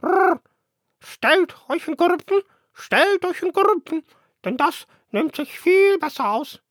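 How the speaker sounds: very high, loud cooing bird voice; mother dove. Acted. Mother dove lines up her young, high cooing voice. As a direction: coo out orders like a fussy mother dove. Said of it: "Die Taubenmutter sagte zu den Jungen".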